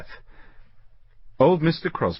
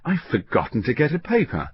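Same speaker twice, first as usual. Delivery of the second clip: raised, higher sudden exclamation; exclaiming; 6 semitones higher than usual. Acted. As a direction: blurt out the sudden realisation. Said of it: "when he exclaimed".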